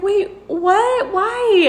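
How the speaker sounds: high, pleading confusion; stunned disbelief. Retold or imitated. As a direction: ask it as if hurt and baffled. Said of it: "I was like".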